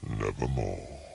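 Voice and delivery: low, hollow raven voice; a dark low croak of a word; raven's croak. A narrator's reading. The raven answers the narrator, low and hollow.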